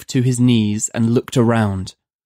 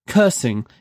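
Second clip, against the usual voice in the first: plain reading voice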